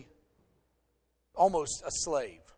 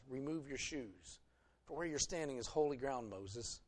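soft, grave voice from the bush, much softer; hushed voice of God; 4 semitones lower, 12 dB quieter than usual. Acted. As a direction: speak as God, hushed and solemn. God speaks from the burning bush.

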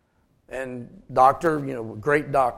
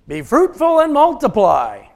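loud, slow, higher proclamation of the verse; booming scripture quote; 8 semitones higher, 8 dB louder than usual. Acted. Preacher calling out the verse to the congregation, booming and slow. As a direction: proclaim it like God's own command. Preacher quoting God's command.

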